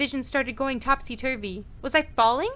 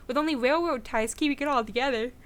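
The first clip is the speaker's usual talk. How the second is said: ordinary talk, nothing marked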